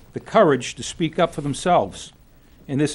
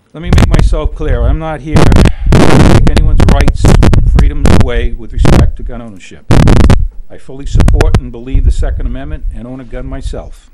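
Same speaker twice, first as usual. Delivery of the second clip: forceful; 12 dB louder than usual. Real, not acted. Emphatic point in public statement.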